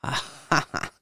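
acted frenzied scornful laughter; disdainful laugh. A narrator's reading. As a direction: laugh with contempt, in broken ha-has. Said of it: "en riant dédaigneusement et avec une sorte de frénésie".

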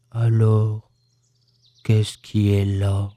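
low, very slow question; gloomy donkey. A narrator's reading. Donkey doubting the bear about his tail, gloomy. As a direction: ask it ponderously in a deep voice.

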